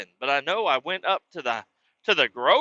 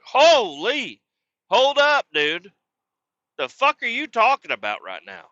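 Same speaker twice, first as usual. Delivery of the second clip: much higher, louder startled outburst; shocked outburst; 6 semitones higher, 4 dB louder than usual. Retold or imitated. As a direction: caught off guard by a threat, loud. Stepfather threatens to beat him.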